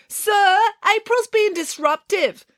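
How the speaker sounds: high-pitched tattling call; drawn-out, very high complaint. A narrator's reading. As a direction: call to the driver to tell on someone. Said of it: "Matilda called out to Mister Lang".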